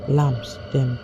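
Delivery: usual speaking voice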